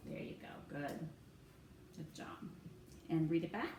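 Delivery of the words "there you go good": normal talking voice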